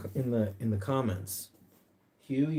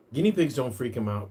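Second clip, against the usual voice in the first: usual speaking voice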